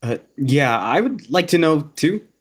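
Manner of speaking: loud, pushy interjection; interjecting. A narrator's reading. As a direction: butt in loudly, pitch climbing. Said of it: "he interjected".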